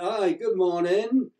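mock a drawn-out, lilting English greeting; English woman's greeting. Englishwoman greeting strangers at hotel breakfast, in a drawn-out English accent. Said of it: "this English thing behind me".

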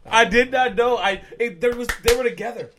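excited. Real, not acted. Excited explanation after punchline.

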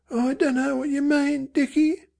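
high, faltering denial; faltering mother. A narrator's reading. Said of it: "she said, but falteringly".

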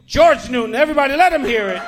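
loud, high emcee shout; stage introduction. Real, not acted. Host bringing new comic onstage, rousing shout. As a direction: call the act on, building in pitch.

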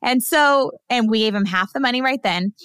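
ordinary delivery

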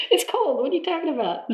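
high, mock-indignant comeback; a teasing, high-pitched objection; indignant retort. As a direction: protest playfully, pitched high. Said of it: "I was like".

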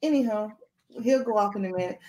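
ordinary talk, nothing marked

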